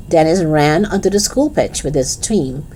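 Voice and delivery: ordinary delivery, nothing marked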